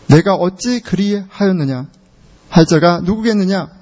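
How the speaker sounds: usual speaking voice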